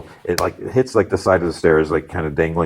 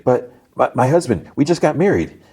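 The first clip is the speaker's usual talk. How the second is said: higher, pleading protest; distressed wife; 7 semitones higher than usual. Retold or imitated. Hostage wife protests leaving her husband, higher and pleading. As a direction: plead lightly in a wife's higher voice.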